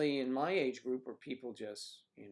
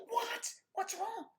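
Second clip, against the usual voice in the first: much higher, startled reply; alarmed; slower than usual. Retold or imitated. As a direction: jump in alarmed at her shriek. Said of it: "I said".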